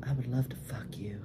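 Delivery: soft breathy whisper of the better line; hushed, intimate whisper; whispered demo. Shown as a demonstration. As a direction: whisper it like a secret to him. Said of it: "just say".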